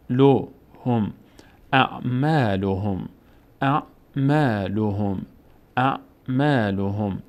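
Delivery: اعمالهم read slowly with the long a after م held; slow, chanted اعمالهم; slow recitation. Shown as a demonstration. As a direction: build up اعمالهم syllable by syllable, then recite it.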